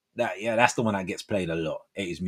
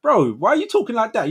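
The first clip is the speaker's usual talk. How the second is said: high, louder imitation of a puzzled mate; mock incredulous challenge; 11 semitones higher, 5 dB louder than usual. Friend questioning his changing voices, mock incredulous. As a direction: call out a bro in mock disbelief.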